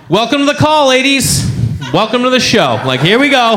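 high, upbeat mock host welcome; show host. Man welcoming ladies to a conference call, mock show host. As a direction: play the master of ceremonies.

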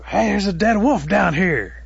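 high, loud, slow holler; stretched-out storyteller's call. Acted. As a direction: shout startling news up to the treetop. Turtle calls up the news.

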